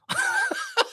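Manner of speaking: real burst of very high laughter; high-pitched cackle. Real, not acted. Fan delighted at the player's wind-up, high cackling laugh. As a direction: cackle with glee, pitched right up.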